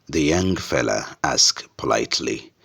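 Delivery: ordinary delivery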